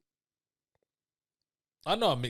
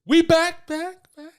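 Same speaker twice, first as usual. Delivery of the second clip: mock echo effect; 12 semitones higher, 8 dB louder, slower than usual. Host opening with echo.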